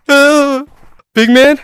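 ordinary delivery

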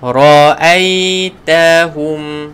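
Ra'aitahum drawn out slowly with heavy ra; teacher modelling Ra'aitahum; slow recitation. Shown as a demonstration.

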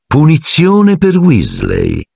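slow, silky menace; lightly voiced silky teacher; silky soft. A narrator's reading. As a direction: purr the punishment slowly. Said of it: "disse Piton con voce soave".

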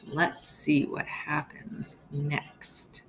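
slow suspenseful whisper. Real, not acted. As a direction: lean in and whisper to the class. Teacher builds suspense.

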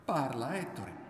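forceful high first word, then easing; urging. A narrator's reading. Woman urging her silent lover, insistent call. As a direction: push him to speak, hitting the first word. She urges him to speak.

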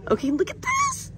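very high, delighted; high squeal of real delight. Real, not acted. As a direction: shriek out the find. Shopper thrilled by new items.

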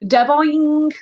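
high, drawn-out "da-boing"; cartoon ball sound; imitated ball bounce. A narrator's reading. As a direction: make a springy bounce noise.

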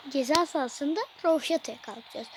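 ordinary talk

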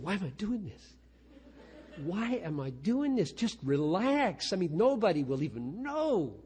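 a lightly voiced frustrated inner voice; inner monologue. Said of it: "I sit there thinking".

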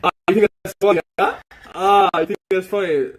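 high, loud, affronted challenge; menacing outrage. Real, not acted. Streamer to someone mocking him, angry challenge. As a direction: throw it back at them, offended.

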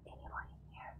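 very soft, cautious whisper; hushed and wary; sneaking whisper. Real, not acted. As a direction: whisper as if hiding from an intruder. Sneaking, calling softly for intruder.

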